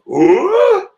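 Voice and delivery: a cartoon yelp of shock; startled yelp. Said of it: "Katakuri's just like".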